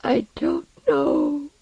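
very high, slow, weak wail; exhausted, high-pitched sobbing denial; sobbing with weakness. A narrator's reading. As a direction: whimper each word, drawn out and tearful. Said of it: "sobbing with weakness".

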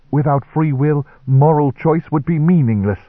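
preachy reverend. Acted.

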